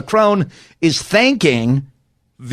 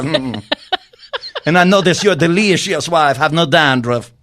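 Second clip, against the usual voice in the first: fake French accent; 3 semitones higher than usual. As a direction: play a smug Macron with a put-on accent. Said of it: "I'm not doing French. I'm doing just something".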